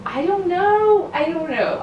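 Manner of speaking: laughing uncertainty. Real, not acted.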